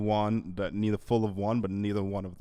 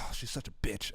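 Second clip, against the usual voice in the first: hushed, unvoiced snide whisper; whispered insult; 7 dB quieter than usual. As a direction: whisper it under your breath. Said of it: "It's like".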